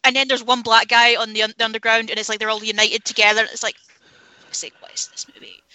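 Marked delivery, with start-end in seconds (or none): 3.8-5.6 barely audible, whispered exasperation; hushed, voiceless aside of despair; exasperated mutter. Acted. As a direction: mutter it under your breath in disbelief. Acting out exasperation at cheesy scene.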